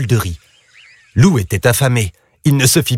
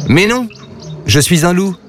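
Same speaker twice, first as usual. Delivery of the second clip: higher-pitched rising objection; protesting; 6 semitones higher than usual. A narrator's reading. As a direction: correct them as the misunderstood wolf. Wolf corrects the mistaken animals.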